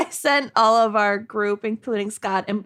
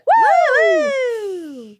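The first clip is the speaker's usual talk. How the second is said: cheering; 12 semitones higher than usual. Real, not acted. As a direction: whoop it out to kick things off. Cheering as show starts.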